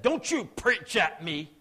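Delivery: retold angry mother, each word hit hard; angry retort. Spit in his face and said.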